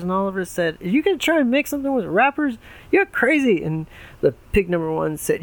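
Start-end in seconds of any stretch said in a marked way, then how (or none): 0.8-3.6 put-on high excited kid voice. Oliver voiced in story.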